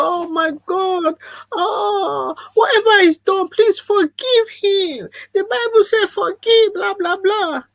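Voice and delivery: high, tearful mock pleading; pleading people. Imitating pleading relatives begging her to forgive him, high and tearful. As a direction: ham up the crying pleas of churchgoers. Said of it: "And the people go".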